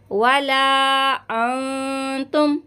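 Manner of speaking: अंतुम with a hummed nasal न; nasal ghunna. Shown as a demonstration. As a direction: hum the न of वला अंतुम through the nose. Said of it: "यहाँ पे भी गुन्ना करेंगे". (अंतुम में गुन्ना)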